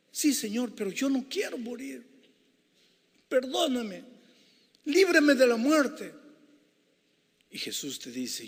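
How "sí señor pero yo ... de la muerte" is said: high, loud begging sinner; desperate plea. Acted. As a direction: cry out for mercy as the sinner. Sinner begs Jesus for life.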